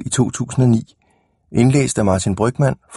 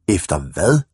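ordinary delivery

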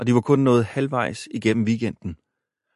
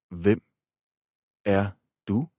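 very slow; slower than usual. A narrator's reading. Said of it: "sagde stemmen meget langsomt".

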